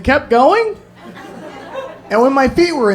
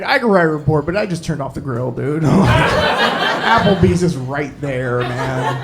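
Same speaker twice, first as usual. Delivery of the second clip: bored cop; 5 semitones lower, slower than usual. Retold or imitated. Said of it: "He's like".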